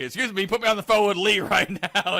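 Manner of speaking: a hint of impatient insistence; American caller. American asking for the lieutenant, mildly pushy demand. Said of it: "The American guy's like".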